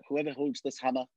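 a light Anthony Hopkins impression; Anthony Hopkins as Odin. Shown as a demonstration. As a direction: intone it like a regal father-king. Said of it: "I can do, uh, an Anthony Hopkins".

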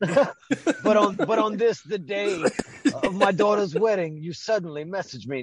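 mock Godfather complaining about the timing; Godfather impression. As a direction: play the offended don at his daughter's wedding. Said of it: "Don Corleone".

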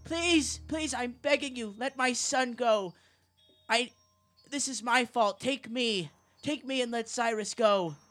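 hysterical begging. Acted. Mother begs the killer for her son, hysterical and frantic. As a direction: scream out a desperate plea to spare her son. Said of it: "Cyrus's mother is in hysterics".

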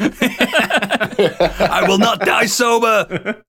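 slow, high drawn-out yell; movie quote shout. Retold or imitated. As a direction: bellow it like a film hero. Quoting Wolf of Wall Street.

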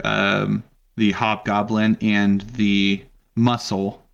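normal talking voice

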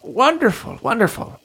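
gleeful mad scientist; mad doctor voice. Acted. Lanfizz delighted.

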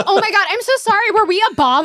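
high, fast, laughing fake sorry; mock apology.

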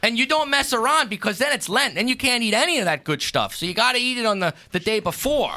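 homey, lightly put-on Pennsylvania Dutch accent. Explaining how to fry Fastnachts, mild Pennsylvania Dutch accent. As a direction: tell the recipe like a Pennsylvania Dutch farmer. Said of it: "just a heavy accent".

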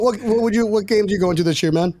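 ordinary talk

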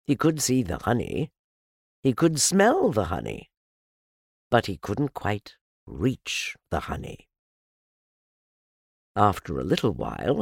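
plain reading voice

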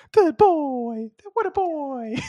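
put-on very high-pitched baby talk to a puppy; squeaky praise for a dog. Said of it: "I go really high, really high pitched".

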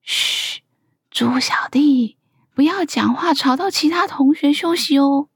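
teacher's hush, whisper easing into voice. A narrator's reading. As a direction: shush a pupil, whispering at first. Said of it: "黑豆老师小声地说".

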